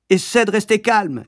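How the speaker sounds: high, firm plea for calm; mother taking charge in a crisis; urgent coaching. A narrator's reading. Mother directs panicked driver.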